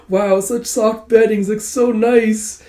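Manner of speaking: high, drawn-out cooing; slow, high swoon of pleasure; blissful delight. Acted. Characters hugging the bed in delight.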